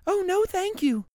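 put-on very high bright child's voice; little girl. Girl begging her mother for the bear, high child voice. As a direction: squeal it like an excited little girl. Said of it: "Lisa answered".

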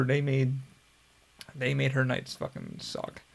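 ordinary delivery

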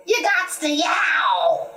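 high, drawn-out yowling cat voice; snapping cat. A narrator's reading. Cat snaps back at the rooster, high and yowly. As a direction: pitch it high and stretch yowl. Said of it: "snapped the cat".

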